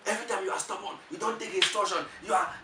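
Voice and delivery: high-pitched, exasperated telling-off; re-enacted angry complaint; mock angry scolding. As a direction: scold your partner in a high, heated voice. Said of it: "I got angry and I flamed up".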